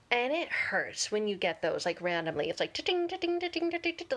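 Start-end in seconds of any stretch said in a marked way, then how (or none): 2.7-4.2 high, chirpy notification pings; imitated phone alerts. Pictures flooding into her phone, imitated high alert pings. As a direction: imitate message alerts piling up. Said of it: "all these pictures come through".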